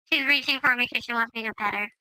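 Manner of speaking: ordinary delivery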